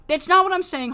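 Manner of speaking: high, loud interruption; an exasperated Sky snapping back; cutting-in shout. Retold or imitated. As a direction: cut him off sharply and loud.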